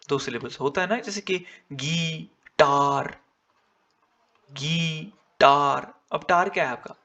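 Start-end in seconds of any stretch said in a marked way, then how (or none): 1.7-3.2 "गी-टार" split slowly in two, weight on "टार"; strong second syllable. Shown as a demonstration. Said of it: "strong syllable है". 4.5-5.9 "गी-टार" drawn out very slowly, "टार" stronger; strong second syllable. Shown as a demonstration. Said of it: "strong syllable है".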